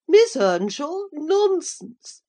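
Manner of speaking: high, loud shocked outcry; a lady scoffing in disbelief; cried out. A narrator's reading. Old lady refuses to believe it's the neighbour's girl, crying out high. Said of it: "cried the dame".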